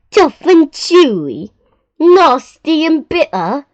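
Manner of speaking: outraged crocodile throwing the words back; indignant crocodile. Acted. Crocodile scoffs at the other.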